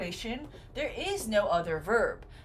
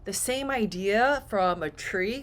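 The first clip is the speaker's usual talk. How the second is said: ordinary delivery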